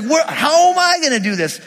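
high-pitched mock helplessness; acted exasperation. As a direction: fret like an overwhelmed Moses, hammed-up. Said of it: "now he's like".

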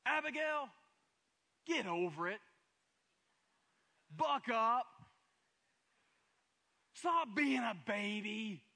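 high, loud, slow mock scolding of a scared child; father pretending to scold his frightened daughter, hammed-up harsh; mock harsh parent. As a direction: bark it like a fed-up dad, then show it's wrong. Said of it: "Is that what we say? No".

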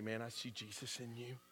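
very soft, earnest murmur; hushed sincere. Retold or imitated. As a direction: say it softly, from the heart. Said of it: "I would say".

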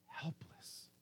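spent, barely audible; helpless whisper. Acted. Psalmist admits to God he is helpless, whispered. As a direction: drop to a bare whisper.

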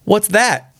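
put-on high-pitched startled old woman; old woman's cry. A narrator's reading. Old woman starting up in bed at the knocking, high cry. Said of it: "cried the old woman".

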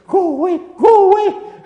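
high, loud buzzing plea from a fly; be a tiny fly shooing off the buffalo's tail; squeaky fly voice. Pretend. Flies squeal at the buffalo's tail, high loud plea.

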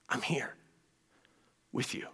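near-whispered, low comfort; hushed and tender, almost a whisper; compassionate reassurance. Acted. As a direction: barely voice it, pausing before the last words. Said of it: "He's showing compassion to say".

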